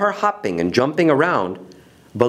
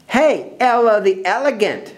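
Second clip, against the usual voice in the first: bully's pitched-up call; shouted taunt; 8 semitones higher than usual. Acted. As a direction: call out teasingly across the yard. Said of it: "shouted Belinda".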